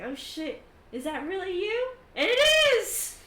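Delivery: climbing in pitch to a loud finish; amazed delight. Acted. Squadmate realises it is his old general, rising amazement. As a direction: build from surprise to loud delight.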